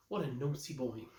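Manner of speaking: ordinary delivery, nothing marked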